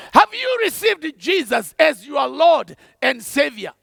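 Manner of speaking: usual speaking voice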